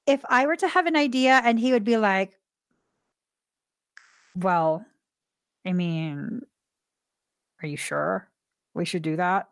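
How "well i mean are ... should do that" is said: low, soft, doubtful voice with long pauses; hesitant husband. Retold or imitated. Husband doubting her idea without saying no, hesitant. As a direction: imitate a gently reluctant partner, low and halting. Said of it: "I'm going to pause".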